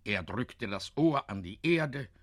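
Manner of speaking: ordinary delivery, nothing marked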